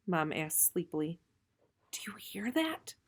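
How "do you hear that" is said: whisper. Acted. Urgent whisper to sleeping mom.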